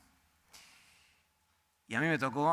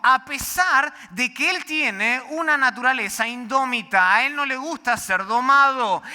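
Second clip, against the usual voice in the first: louder, higher, driving sermon delivery; full-force preaching; 6 semitones higher, 6 dB louder than usual. Acted. Pastor drives home the horse illustration, full-force preaching. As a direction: preach it hard to the back row.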